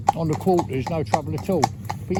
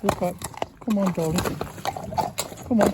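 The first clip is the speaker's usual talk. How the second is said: softer urging of an animal; quiet urging; 5 dB quieter than usual. Real, not acted. To the reluctant horse: quiet, gentle urging. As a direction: coax him on quietly and gently.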